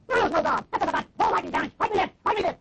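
put-on retelling a drunk man daring God; retold drunk screaming. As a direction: barroom dare shouted at the ceiling. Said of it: "And he started screaming".

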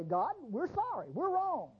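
a hint of whiny repentance; mock apology. The men say sorry to God, light mock pleading. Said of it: "they come to God and they say".

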